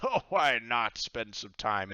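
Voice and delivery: loud, slow pirate coax; pirate captain coaxing. Acted. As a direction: tempt her to stay, hearty. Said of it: "he says".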